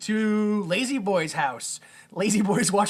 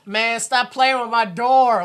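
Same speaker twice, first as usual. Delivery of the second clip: put-on Lazy Boy complaining; 6 semitones higher, 6 dB louder, slower than usual. Lazy Boy trapped behind his door.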